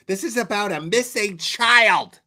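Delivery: high, loud mocking voice; mocking impression. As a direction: parrot her line with scorn. Mocking the streamer's line.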